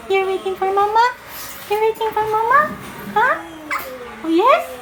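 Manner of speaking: very high doting questions; high puppy-talk with rising ends. Real, not acted. Teasing questions to pet, high.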